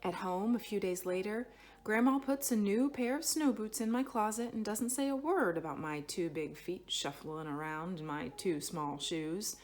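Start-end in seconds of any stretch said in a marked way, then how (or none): none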